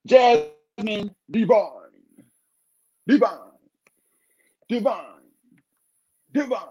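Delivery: high, stretched announcer call with echoing repeats; MC name call. Acted. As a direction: announce her like a club MC. Hyping guest's name like an MC.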